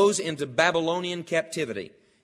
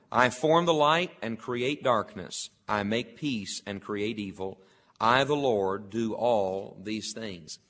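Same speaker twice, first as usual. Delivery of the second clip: solemn scripture reading; 4 semitones lower than usual. Acted. Preacher reading Isaiah aloud to the congregation, solemn and measured. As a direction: read the prophecy with reverent weight.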